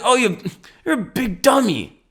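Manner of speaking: put-on high, childish sneering voice; mocking kid self. Remembering his kid self sneering at a horse, high childish voice. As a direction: play your dumb little kid self scoffing at animals. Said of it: "when I was a kid, I thought animals were just stupid things".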